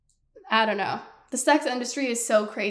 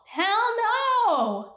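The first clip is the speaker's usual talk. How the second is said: outraged hell no, much higher; 10 semitones higher than usual. Real, not acted. Outraged reaction, pitch peaks.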